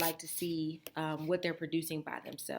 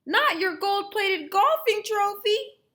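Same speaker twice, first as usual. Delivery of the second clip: Mom's worried guesses; 10 dB louder than usual. Acted. Mom guessing stolen items.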